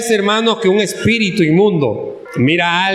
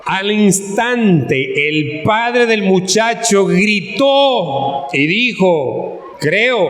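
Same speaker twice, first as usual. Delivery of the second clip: very slow, stretched-out dramatic reading; scripture reading; 3 semitones higher, much slower than usual. Acted. Preacher reads of the father crying out, slow solemn scripture reading. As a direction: linger on each phrase of the verse. Reads the Bible verse aloud.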